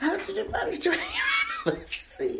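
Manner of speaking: high, cracking up mid-question; laughing disbelief. Real, not acted. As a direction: squeal it through fits of laughter. Laughing at his head bump.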